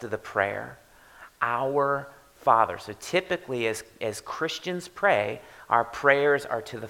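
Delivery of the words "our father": slow, weighted Our Father; solemn emphasis. Shown as a demonstration. Pastor pausing on Our Father for the church, solemn. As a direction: savour the two words. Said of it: "set the tone".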